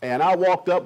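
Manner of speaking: ordinary talk, nothing marked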